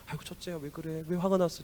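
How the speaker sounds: soft, coaxing father; father soothes his sulking eldest son, softly; soothing father. Acted. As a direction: coax him gently like a worried dad.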